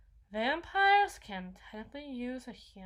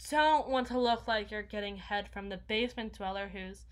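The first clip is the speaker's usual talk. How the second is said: usual speaking voice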